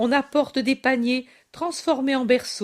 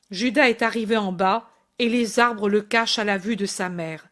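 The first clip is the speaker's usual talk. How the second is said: ordinary delivery, nothing marked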